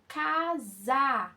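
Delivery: "Casa" stretched into two long syllables; slow teacher's "Ca-sa"; sound it out. Shown as a demonstration. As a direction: draw out "Casa" slowly so each sound is heard. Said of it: "olha o som".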